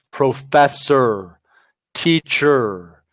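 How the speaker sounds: long held "er" ending "professor" and "teacher"; drawn-out ER sound. Shown as a demonstration. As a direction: stretch the final r-coloured vowel in "professor, teacher". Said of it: "Like the E R sound".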